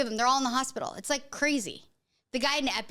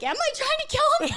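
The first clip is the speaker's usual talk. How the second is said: alarmed; 14 semitones higher, 4 dB louder than usual. Retold or imitated. Mother doubts herself, alarmed.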